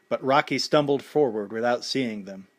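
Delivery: plain reading voice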